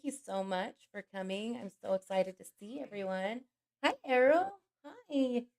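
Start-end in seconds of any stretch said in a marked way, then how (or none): none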